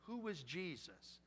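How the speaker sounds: ordinary talk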